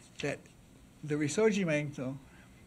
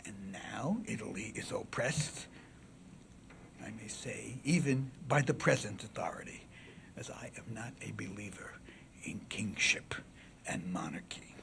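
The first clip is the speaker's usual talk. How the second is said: classical oration; 3 semitones lower, 7 dB quieter than usual. Acted. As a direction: address the jury gravely with long pauses. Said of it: "was classical oration".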